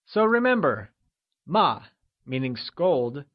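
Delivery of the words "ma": "ma" short, pitch dropping quickly; falling tone. Shown as a demonstration. Teacher translates scold, "ma" in falling tone. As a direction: let "ma" drop sharply from the top. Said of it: "carries the falling tone".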